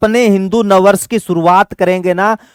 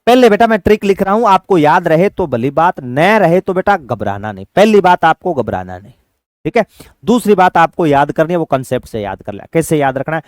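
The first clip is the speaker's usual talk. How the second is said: ordinary talk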